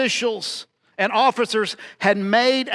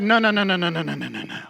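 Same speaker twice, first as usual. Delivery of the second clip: fading protest; 4 semitones lower, much faster than usual. Acted. Peter protesting Jesus's death.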